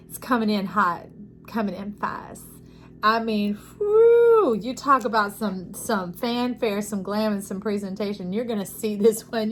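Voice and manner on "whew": high loud whoop of delight; excited whoop. Real, not acted. Reader hyped about the incoming fanfare, whooping. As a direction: let out a high whistle-like whew. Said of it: "exclaims at the fanfare".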